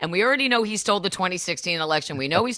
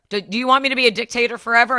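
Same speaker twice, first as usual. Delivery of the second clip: fast, louder mocking question; mocking Trump; 6 semitones higher, 5 dB louder, much faster than usual. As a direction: sneer it out quickly like a would-be dictator. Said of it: "He's like".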